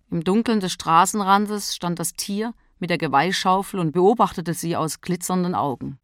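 plain reading voice

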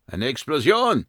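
high, alarmed cry; calling out. Acted. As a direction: call out the alarm. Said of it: "rief jemand".

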